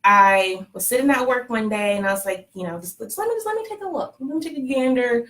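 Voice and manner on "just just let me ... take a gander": coy curiosity. Retold or imitated. Patient browsing donor profiles at work, coyly curious. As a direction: go up high and light, playfully coy. Retells sneaking a look at donors.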